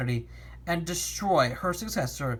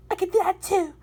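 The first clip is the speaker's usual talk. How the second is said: high character voice; 13 semitones higher, 4 dB louder than usual. Acted. Character line in story.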